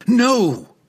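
high, loud furious shout; enraged shout. Acted. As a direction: scream it in rage. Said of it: "he shouted back in rage".

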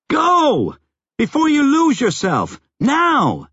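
high-pitched urgent yelling. A narrator's reading. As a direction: yell to rouse someone. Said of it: "she yelled".

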